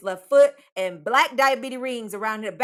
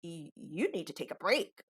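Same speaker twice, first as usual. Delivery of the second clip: coworker's calm reply; 3 semitones lower, 10 dB quieter than usual. Retold or imitated. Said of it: "And then he says to me".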